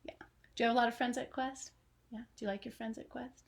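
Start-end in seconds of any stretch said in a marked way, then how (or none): none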